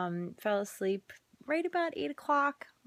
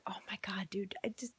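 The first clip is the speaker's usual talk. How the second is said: exasperated whisper; 8 dB quieter, faster than usual. Retold or imitated. Exhausted mom at night.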